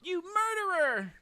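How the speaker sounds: high, loud, slow accusing scream; furious accusation. Acted. Boy screams at the doctor, furious shout. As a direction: hurl the accusation at him.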